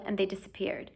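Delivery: ordinary delivery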